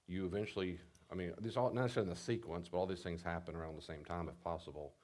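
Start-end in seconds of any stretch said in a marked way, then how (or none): none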